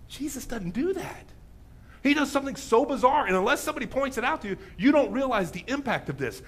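ordinary talk

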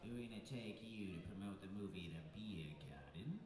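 low, soft Aussie voice; mock Australian promoter pitching an idea; Australian accent. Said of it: "and they say".

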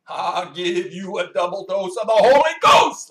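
hammed-up televangelist bellowing at the crowd; Pentecostal evangelist. Evangelist shouting at a blind woman in the aisle, mock booming. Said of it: "he goes like this".

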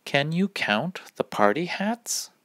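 plain reading voice

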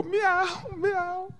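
high, soft mock meows; imitated cat meow. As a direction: mimic a cat in a small high voice. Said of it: "the bird would go".

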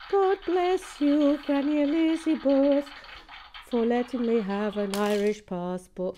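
hammed-up Irish blessing. Hailing her Irish grandmother for the passport, hammed-up Irish accent. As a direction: bellow the blessing like a grand old Irish toast. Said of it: "I do love a good Irish accent".

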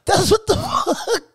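high, loud incredulous yelp; hammed-up high shocked voice; mock disbelief. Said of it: "I was like".

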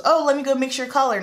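imitating an easy cover story; breezy cover-up excuse. Excuse made before crying.

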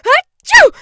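huge high achoo; explosive sneeze; loud sneeze. A narrator's reading. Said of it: "odd sort of punctuation".

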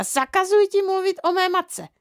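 high-pitched outraged order; angry shouted ban. A narrator's reading. Mother forbidding father to mention her mother, angry. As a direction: forbid him in a strained high voice. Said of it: "křičela maminka".

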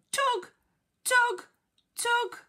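each "tug" high and separate, with a pause between; effortful repeated tugs. Acted. Teacher acting Duck pulling the net, punched tugs.

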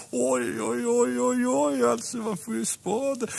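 high laughing oj oj; laughing bear. Acted. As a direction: laugh it off, amused. Baloo laughing at apple worms. (skrattande björn)

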